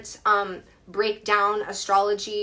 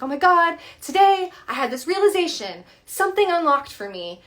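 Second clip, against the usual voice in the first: imitating a friend's high, loud excitement; very excited; 8 semitones higher, 6 dB louder than usual. Retelling best friend's voice note about a realization, very excited. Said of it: "very excited, right? And she's like".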